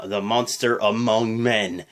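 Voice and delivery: slow, low announcer billing; announcer-style "the monster among men"; ring announcer. Retold or imitated. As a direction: stretch out "monster" like an arena intro. Announcer take vs recap.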